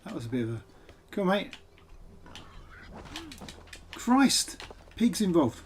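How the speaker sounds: startled. Real, not acted. Surprised by attacking pig.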